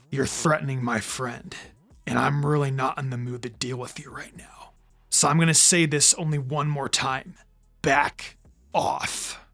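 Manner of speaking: quiet, low, icy threat; cold calm anger. A narrator's reading. Naruto warning the enemy off his friend, icy quiet. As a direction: hold the rage down, quietly. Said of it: "so angry, yet calm".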